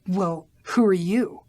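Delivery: slow, suspicious hiss. A narrator's reading. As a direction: challenge the stranger at the door.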